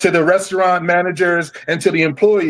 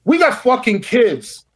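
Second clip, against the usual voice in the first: angry; 7 semitones higher than usual. Real, not acted. Angry rant continues.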